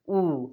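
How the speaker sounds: ऊ as a long "oo"; Spanish U vowel. Shown as a demonstration. Teacher models last vowel, long isolated ऊ. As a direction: hold ऊ alone, as in mood. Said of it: "वही तो pronunciation है last vowel".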